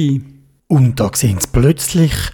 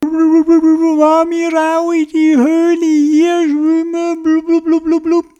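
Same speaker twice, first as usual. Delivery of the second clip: underwater gurgling voice; 12 semitones higher than usual. A narrator's reading. Theobald speaks underwater.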